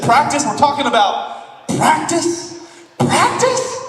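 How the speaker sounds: mock disbelief at being asked to practice; hammed-up Iverson rant, last practice squeaked way up; Allen Iverson. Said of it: "What's Allen Iverson's famous quote?".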